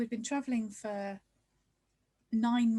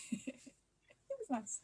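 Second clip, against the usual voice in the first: wistful; 4 semitones higher, 8 dB quieter, much slower than usual. Real, not acted. Wistful recall of beach Christmas.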